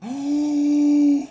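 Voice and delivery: high loud startled oh; alarmed cry. Real, not acted. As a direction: gasp it out in shock. Surfacing under fire.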